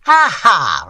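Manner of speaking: loud mocking ha ha; scornful laugh. A narrator's reading. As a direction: laugh in his face. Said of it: "retorted Boomley scornfully".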